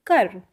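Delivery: कर with plain k; कर, not घर; Punjabi kar. Shown as a demonstration. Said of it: "Hindi में घर बोलते हैं".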